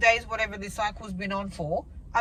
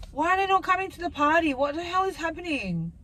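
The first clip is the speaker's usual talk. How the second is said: higher-pitched, baffled complaint; exasperated; 6 semitones higher than usual. Real, not acted. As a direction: demand answers from the absent follicles. Exasperated at follicles not growing.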